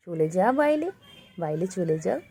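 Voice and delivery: normal talking voice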